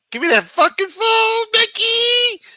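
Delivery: high-pitched, full-volume shriek; furious mock scream. Wife yelling at Mickey over his phone, high furious scream. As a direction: scream it like a spouse grabbing for the phone.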